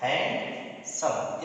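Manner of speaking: Handsome slowly, stopping between hand and सम; hand, a clear pause, then सम in handsome; pause between syllables. Shown as a demonstration. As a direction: break handsome into two spaced beats. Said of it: "hand और सम के बीच में आपको रुकना पड़ रहा है".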